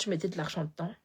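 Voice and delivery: ordinary talk, nothing marked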